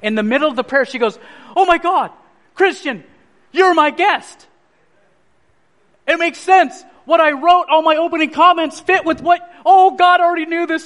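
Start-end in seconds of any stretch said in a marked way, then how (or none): 1.6-10.8 high, gushing astonishment; retold thrilled exclamations; excited revelation. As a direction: imitate a friend struck by sudden realisation, getting louder. Cherie's sudden revelation mid-prayer, retold.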